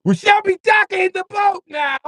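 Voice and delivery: very high, loud pompous proclamation; hammed-up captain calling out docking; mock captain announcement.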